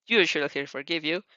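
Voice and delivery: ordinary delivery, nothing marked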